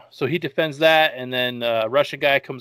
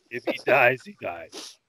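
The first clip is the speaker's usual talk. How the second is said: put-on Russian accent. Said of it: "Great Russian accent".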